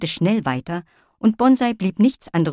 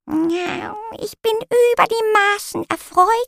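posh cat voice; slower than usual. Acted. The refined cat speaks.

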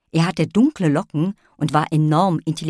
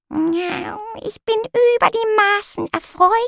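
high, dainty little cat lady; meowing cat voice. Acted. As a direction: meow it like a posh little cat. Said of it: "miaute die kleine Katzendame".

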